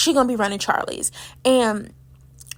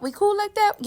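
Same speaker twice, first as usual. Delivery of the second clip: sarcastic question; 10 semitones higher than usual. Shown as a demonstration. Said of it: "Y'all not cool like that".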